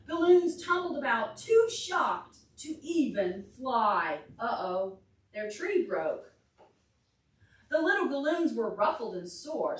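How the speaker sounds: lively read-aloud to a class; story reading for children. Acted. Teacher reading a picture book to the class, lively storytime voice.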